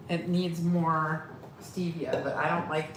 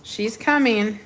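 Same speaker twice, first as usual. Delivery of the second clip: calling to the dog; 6 semitones higher, 8 dB louder than usual. Real, not acted. Shouting to the dog.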